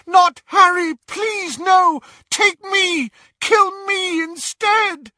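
very high, screamed begging; desperate pleading. Acted. Mother offering herself in her son's place, frantic. Mother begs to die instead.

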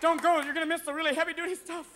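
high, urgent mock plea; hammed-up call to someone leaving; pleading. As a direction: beg them to stay, pitched high. Mock plea to departing audience.

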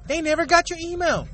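ordinary talk

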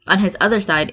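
ordinary delivery, nothing marked

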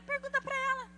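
high-pitched mimicked retort; put-on high voice passing the question on; imitated curt deflection. Parent told to ask her, mimicked retort.

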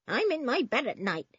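put-on high-pitched old woman's voice; cranky old woman. A narrator's reading. Old woman answering the policeman, high and cranky.

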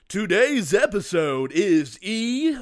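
slow, loud, big announcer read; booming announcer. Acted. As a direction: bring it from the gut. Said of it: "Get some feeling, get it in your gut".

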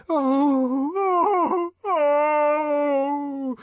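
high wailing moans; inarticulate ecstatic cries. A narrator's reading. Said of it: "Joanna inarticulately testified".

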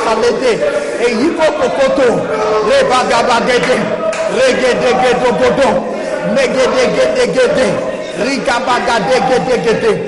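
rhythmic fervent tongues chant; speaking in tongues. Acted. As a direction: pour out rapid tongues in prayer. Speaking in tongues before prayer.

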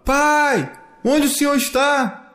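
high searching call; child calling, vowels stretched high. A narrator's reading. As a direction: call for father across a distance. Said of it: "ele fala".

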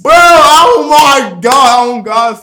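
high, loud, drawn-out disbelief; amazed outcry. Retold or imitated. Man replaying the insult for his friend, amazed.

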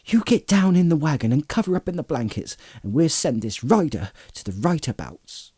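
urgent, starting high then settling; mildly worried servant giving instructions; anxious. A narrator's reading. Sam hiding Frodo in the wagon, anxious. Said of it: "said Sam anxiously".

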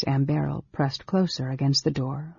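ordinary delivery, nothing marked

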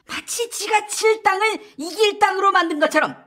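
high-pitched, exasperated telling-off; heated scolding. Acted. Political rival calling out the other's bluffing, heated scolding. Acted quarrel, accusing insult.

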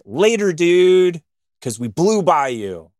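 mocking a rival racer, slow smug goodbye; taunting farewell. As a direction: stretch it out to rub it in. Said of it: "They'd be like".

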